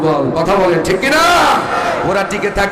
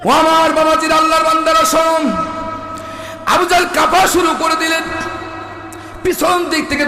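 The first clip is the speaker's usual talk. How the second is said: calling out; 12 semitones higher than usual. Acted. Calls out to the congregation.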